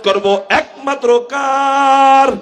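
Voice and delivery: high, loud and slow call; calling out to crowd. Real, not acted. Preacher prompting listeners to shout back, loud slow call. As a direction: stretch it out and rouse the crowd. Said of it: "চিৎকার দিয়ে বলেন না".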